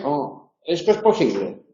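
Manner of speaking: falling statement tune on posible. Shown as a demonstration. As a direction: state it flatly, falling at the end. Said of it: "el punto enunciativo".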